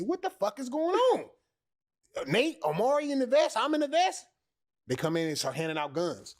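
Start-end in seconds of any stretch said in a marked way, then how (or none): 0.0-4.2 high, loud, incredulous; can't believe what you're seeing; pitched-up shocked rant; excited disbelief. Retold or imitated. Retold shock at matching vests.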